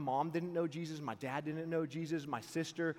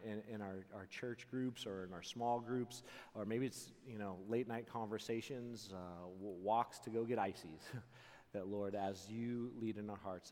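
prayer; 4 semitones lower than usual. Real, not acted. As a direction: pray it gently over the crowd. Closing prayer.

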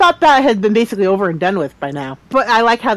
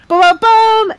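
loud, high mock fanfare; dramatic sting; 11 semitones higher, 5 dB louder, slower than usual. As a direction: hum a big reveal sting.

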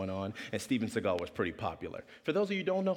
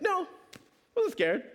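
high little-kid voice, acted; retold kid's high-pitched denial; child denying fear. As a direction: pipe up in mock childish bravado. Boy answering his dad.

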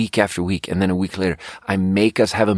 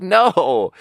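flat denial; 9 semitones higher, 4 dB louder than usual. Retold or imitated. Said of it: "And it's like".

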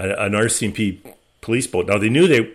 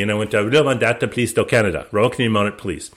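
usual speaking voice

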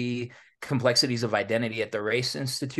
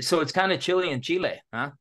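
punny joke; 7 semitones higher, faster than usual. Real, not acted. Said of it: "See what I did there?".

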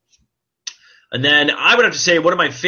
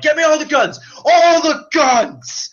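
much higher, louder, slow commanding call; mock battle cry; 5 dB louder, slower than usual. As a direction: demand the guns with bravado. Imitating Deadpool arming up.

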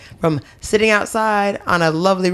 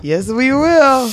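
excited cheer; 9 semitones higher, 3 dB louder, much slower than usual. Real, not acted. Hyped cheer for Venom.

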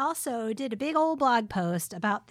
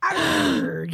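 pirate voice; 5 semitones higher than usual. Pretend. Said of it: "Like a pirate".

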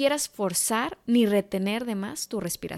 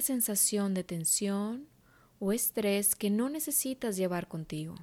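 guided meditation; 6 dB quieter than usual. Acted. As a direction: guide her gently into calm.

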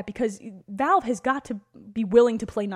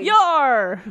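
pirate voice; 5 semitones higher, 9 dB louder than usual. Pretend. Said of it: "Talk Like a Pirate Day".

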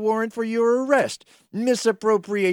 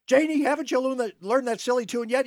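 normal talking voice

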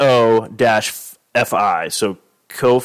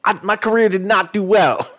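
Cuba; 7 semitones higher than usual. Retold or imitated. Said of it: "He's like".

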